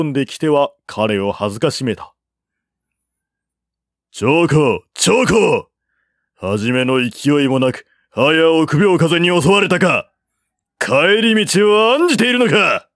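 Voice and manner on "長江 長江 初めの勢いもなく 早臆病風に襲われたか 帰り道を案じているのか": loud, rising jeering challenge; mocking taunt. A narrator's reading. Officer taunting the general as a coward, loud jeer. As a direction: goad him into charging.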